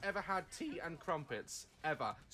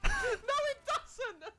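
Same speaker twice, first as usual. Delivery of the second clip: laughing; 6 dB louder, much slower than usual. Real, not acted. Laughing at the clip.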